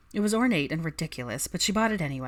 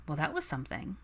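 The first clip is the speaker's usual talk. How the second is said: usual speaking voice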